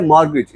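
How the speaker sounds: मॉर्गेज with no T, "mor-gij"; silent T. Shown as a demonstration. Teacher gives the answer, मॉर्गेज with silent T. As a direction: say मॉर्गेज without T, short second syllable. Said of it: "टी silent है".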